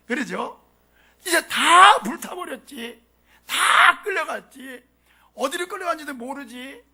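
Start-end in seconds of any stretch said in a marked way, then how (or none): 1.3-6.8 tearful lament. Acted. Lamenting the people's loss.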